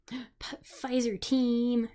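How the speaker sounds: high, loud, long-held TEAM; drawn-out team cheer. Real, not acted. Vaccinated streamer hailing fellow Pfizer recipients, drawn-out cheer.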